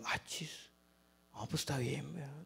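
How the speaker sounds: soft, deflated sigh of relief; hushed relief at good news; relieved murmur. Retold or imitated. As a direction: let the tension drain out quietly. Relieved reply after lodging granted.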